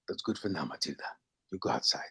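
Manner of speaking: a hint of cool hitman calm; quiet calm order. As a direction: hush it to the girl so the mark can't hear. Leon sends Matilda out.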